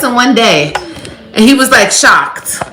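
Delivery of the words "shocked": ordinary talk, nothing marked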